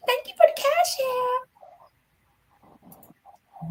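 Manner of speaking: put-on very high, sugary little-girl voice; baby voice. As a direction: coo it like a spoiled toddler. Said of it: "And the baby voice".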